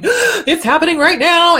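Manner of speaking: high, loud alarmed cry with a laugh; panicked amygdala. Retold or imitated. Amygdala panicking that the danger is happening now, alarmed. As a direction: play the brain's panic alarm. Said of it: "the amygdala is going".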